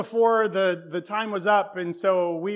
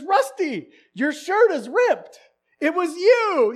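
high, slow, firm mother voice; stern mom; 10 semitones higher, 9 dB louder, slower than usual. Retold or imitated. Mom insisting to Rusty it was him, stern. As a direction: lay it down like a mom. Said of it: "She says".

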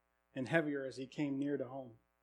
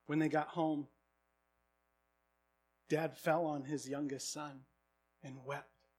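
ordinary talk, nothing marked

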